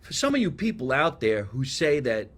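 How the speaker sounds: normal talking voice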